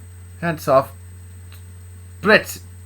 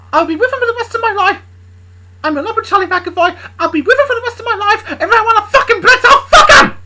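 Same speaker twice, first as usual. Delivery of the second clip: high, loud tirade building to a scream; defiant yelling; 9 dB louder than usual. Acted. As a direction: shout your defiance, climbing to a shriek. Luna defies her father, quarrelling.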